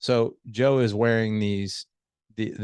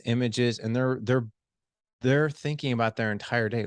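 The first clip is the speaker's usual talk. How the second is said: ordinary talk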